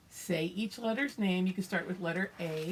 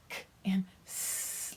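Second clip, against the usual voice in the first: "It says K and S" whispered; whispering; 2 semitones higher, slower than usual. Shown as a demonstration. Teacher demonstrates letter sounds.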